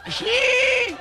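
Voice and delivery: very high squeaky yes; old man voice. Retold or imitated.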